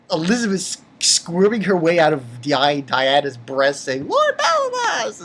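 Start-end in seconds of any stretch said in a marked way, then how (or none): 4.1-5.2 put-on much higher girlish call; imitating a girl's plea. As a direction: call his name high like a young girl. Elizabeth crying out name.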